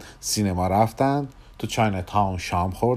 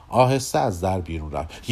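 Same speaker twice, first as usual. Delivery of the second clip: ordinary delivery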